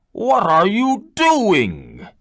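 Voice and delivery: high, slow furious cry of a beast; angry beast roar. Acted. Angry beast catching the man stealing roses, slow furious cry. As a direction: rear up and demand an answer. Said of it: "it cried".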